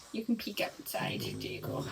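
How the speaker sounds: soft unvoiced whisper to a pet; hushed whisper so as not to disturb; whisper to dog. Real, not acted. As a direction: breathe it to the dog without voice.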